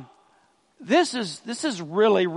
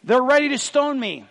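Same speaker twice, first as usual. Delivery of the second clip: higher and louder, exasperated cry; frustrated Moses; 6 semitones higher, 4 dB louder than usual. Acted. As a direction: Moses fed up, pleading with the Lord. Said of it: "He's frustrated".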